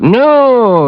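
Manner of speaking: loud, high burst of no; sharp denial. Acted. Partner jumps in to deny trouble, loud and high. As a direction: blurt the no out loud.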